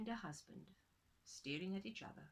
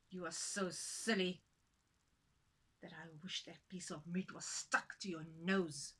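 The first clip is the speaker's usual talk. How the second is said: scornful husband; 3 semitones lower than usual. Acted. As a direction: sneer out the insult. Husband still scolding.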